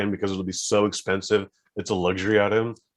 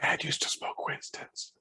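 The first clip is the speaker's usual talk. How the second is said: whispering; 11 dB quieter than usual. Real, not acted. Dark joke aside.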